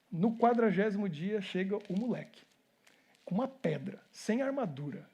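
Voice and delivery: ordinary talk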